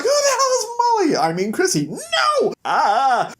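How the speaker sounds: very high, shrieking woman's cry; outraged scream. Acted. Woman yells at Prince Charming over her name, shrieking high. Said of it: "And she's like".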